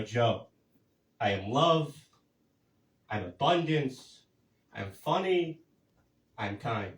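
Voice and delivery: slow, soft self-affirmation to the mirror. Retold or imitated. To himself in the mirror, soft slow affirmations. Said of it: "I go".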